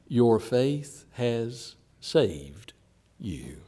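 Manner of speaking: "Your faith has saved you" said very slowly, word by word; slow literal reading. Shown as a demonstration. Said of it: "if you translate that very closely word for word".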